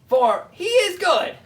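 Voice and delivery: ordinary talk, nothing marked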